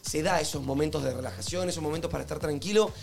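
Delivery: soft relaxing ad read. Acted. Host pitching incense to listeners, softly and calmly. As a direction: sell tranquility gently and quietly.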